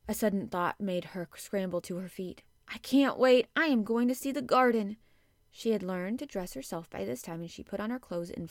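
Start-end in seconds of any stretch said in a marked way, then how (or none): 2.7-5.0 loud, high burst of excitement; urgent, excited resolve; eager impatience. A narrator's reading. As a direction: blurt it out, bursting to go.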